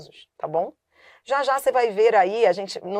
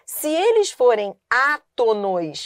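ordinary delivery

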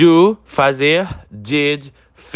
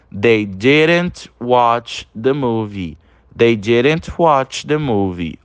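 slow sentence with "didn't" said whole; slow model reading; much slower than usual. Shown as a demonstration. Teacher reading the contracted example to students, slowly. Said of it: "na forma contraída fica".

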